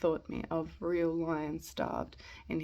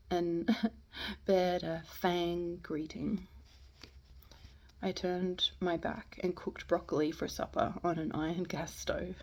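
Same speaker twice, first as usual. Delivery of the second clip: ordinary delivery, nothing marked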